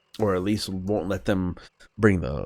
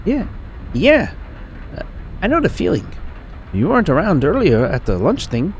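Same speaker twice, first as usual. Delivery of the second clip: high, lilting put-on female accent; Maya; 11 semitones higher, 4 dB louder than usual. Traveling artist introducing herself, amateur female accent. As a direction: attempt a higher female voice with an accent. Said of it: "I'm trying to do an accent".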